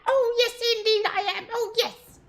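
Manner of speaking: very high, loud, far-off dwarf voice with a cackle; a raving old dwarf, high and wild; delirious dwarf. Acted. Delirious dwarf raving about bringing the hammer.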